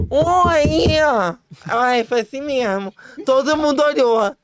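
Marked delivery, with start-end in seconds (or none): 0.1-1.0 "Oi!" agudo e alto, chamando; chamado alto. Retold or imitated. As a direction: grite oi para a fila de homens. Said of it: "aí eu". (chamado agudo e alto pela janela do carro)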